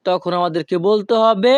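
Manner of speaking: ordinary talk, nothing marked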